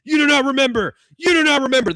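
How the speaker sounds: high, loud repeated scolding; God yelling. Retold or imitated. God yelling at paralyzed Bob, high and loud. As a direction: bellow it down at him. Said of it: "This is God yelling at him".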